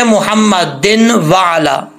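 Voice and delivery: मोहम्मदिन वाला with a plain separate n before वाला; common misreading. Shown as a demonstration. Teacher showing the big mistake, clear n in मोहम्मदिन. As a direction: pronounce a full "din", then वाला apart, no merging. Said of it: "जो बड़ी mistake है".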